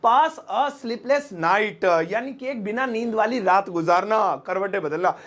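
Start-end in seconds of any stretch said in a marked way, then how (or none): 0.1-2.1 "pass a sleepless night" stretched word by word; very slow model. Shown as a demonstration. Teacher repeats the English phrase very slowly. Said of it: "कहेंगे pass a sleepless night".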